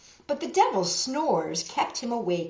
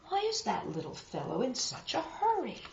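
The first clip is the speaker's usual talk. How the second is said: soft, lightly higher puzzled voice; wondering; 5 semitones higher, 6 dB quieter than usual. Acted. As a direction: muse it aloud, puzzled. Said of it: "Paper John wondered".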